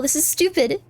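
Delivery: ordinary talk, nothing marked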